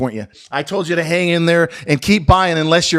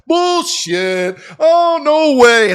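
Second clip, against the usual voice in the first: mock disbelief; 8 semitones higher, much slower than usual. Mocking disbelief from bar guys.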